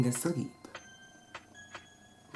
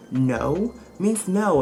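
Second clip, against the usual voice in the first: each word hit slow, higher and louder; slow emphatic no means no; 5 semitones higher, 6 dB louder, much slower than usual. Real, not acted. To the men watching, a slow, punched lesson. Lecturing viewers on consent.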